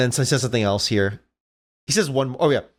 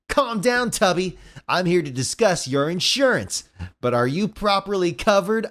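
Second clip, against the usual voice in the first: higher-pitched smug hero voice; teasing cartoon hero, pitched up; cocky taunting Sonic; 6 semitones higher, slower than usual. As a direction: taunt the villain with a mock sales pitch.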